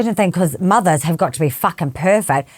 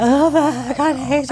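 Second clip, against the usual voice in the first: mock-wailing plea, higher pitched; retold distress; 7 semitones higher than usual. Upset mum turning to her friend, mock distress. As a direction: imitate a higher, needy cry for help.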